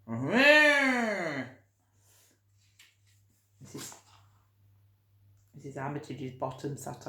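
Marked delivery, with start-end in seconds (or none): 0.1-1.5 high, loud rolled rrr horn; child-story horn sound; horn noise. Retold or imitated. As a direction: blare like a bike horn. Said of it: "the horn's going".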